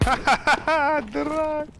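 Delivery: high slow taunting laugh; mocking laugh. Real, not acted.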